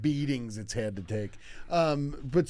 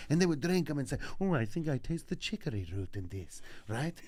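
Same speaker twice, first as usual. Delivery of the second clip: put-on Italian storyteller accent; sad Italian; 4 semitones higher than usual. Comic telling a boyhood detention story, mock Italian accent. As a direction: melodramatic Italian accent with extra vowels between words.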